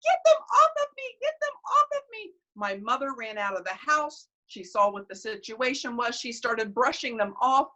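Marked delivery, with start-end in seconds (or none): 0.0-2.3 high, fast frightened shrieking; child screaming in a panic; panicked screaming. Retold or imitated. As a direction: scream to get them off. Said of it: "I started screaming".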